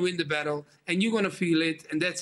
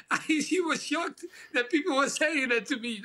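laughing high as he tells it; laughing at the memory; 6 semitones higher than usual. Real, not acted. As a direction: giggle through the words. Laughing while telling.

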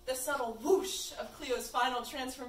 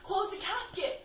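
raised, high-pitched yell; 8 semitones higher, 6 dB louder than usual. Acted. Lewis orders Zane to shut the casket, yelling. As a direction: call it out as an urgent order. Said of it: "Lewis yelled".

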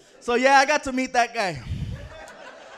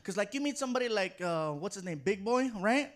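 ordinary delivery

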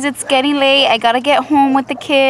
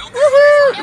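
excited cheer; 13 semitones higher, 7 dB louder than usual. Real, not acted. Cheering over ice cream cones.

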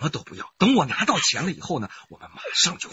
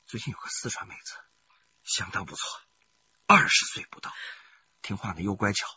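much softer, lowered confiding; conspiratorial hush; 7 semitones lower, 6 dB quieter than usual. Acted. Husband confides his new target to his wife, hushed. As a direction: hush it like a secret.

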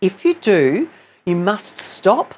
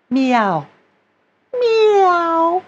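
free flowing meow; 10 semitones higher, much slower than usual. Shown as a demonstration. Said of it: "flowing freely without any constriction".

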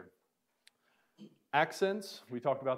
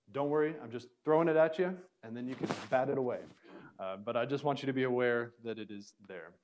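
ordinary talk, nothing marked

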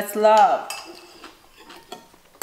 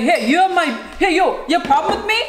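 mock tough-guy challenge; 6 semitones higher, faster than usual. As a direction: square up to another guy.

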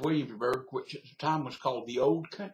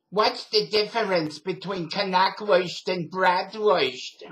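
loud, slow New York lady imitation; New York accent; 5 semitones higher, 7 dB louder, slower than usual. Old New York lady at deli line, loud New York accent. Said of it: "had never heard a New York accent".

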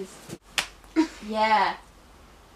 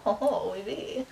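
impression of friend; 3 semitones lower, 8 dB quieter, slower than usual. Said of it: "best impression of me".

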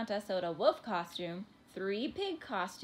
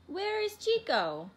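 high, loud, drawn-out question; calling out; 12 semitones higher, 6 dB louder than usual. A narrator's reading. Mother looking for her missing son, calling out. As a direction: call out slowly for a missing child, pitched high.

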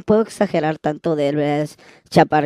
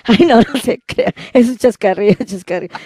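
laughing; 7 semitones higher, 7 dB louder than usual. Real, not acted. Laughing at her joke.

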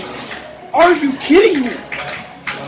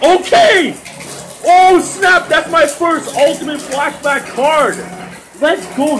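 excited shout; 2 semitones higher than usual. Real, not acted. Gamer getting a first flashback card, excited shout.

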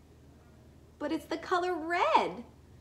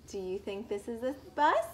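ordinary delivery

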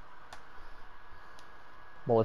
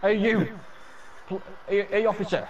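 calling out; 8 semitones higher than usual. Pretend. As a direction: shout to get the guard's attention. Calling out to guard.

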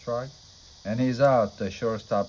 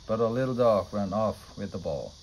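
ordinary delivery, nothing marked

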